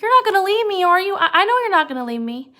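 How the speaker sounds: put-on high, loud, childlike pleading; Lenny anxious. Lenny asking George not to leave, high and insistent. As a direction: imitate Lenny checking up, pitched well up. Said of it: "He was like".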